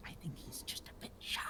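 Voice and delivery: quick, very soft whisper; confiding whisper. Acted. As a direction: whisper it fast, as a discreet aside. Confiding about the shy toad.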